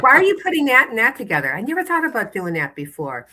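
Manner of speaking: high, fast gushing voice; excited shopper. Retold or imitated. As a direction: gush like an eager fan. Shopper gushing over her groceries.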